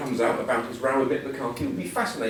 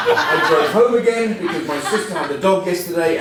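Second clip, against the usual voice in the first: laughing; 4 semitones higher, 6 dB louder than usual. Real, not acted. Laughing through the story.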